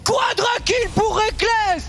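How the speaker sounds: shouted, stretched kill call; esports caster. Real, not acted. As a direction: scream the multikill in disbelief. Caster screams at quadra kill. (hurlement de commentateur esport)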